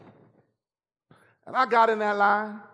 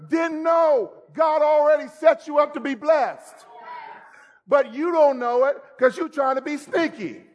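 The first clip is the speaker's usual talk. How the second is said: preaching; 6 semitones higher than usual. Real, not acted. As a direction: drive the point home from the pulpit. Preaching at full force.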